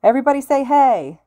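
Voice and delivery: loud, high crowd hype call; rally the whole hall; shouting. A narrator's reading. Hero pumps up crowd.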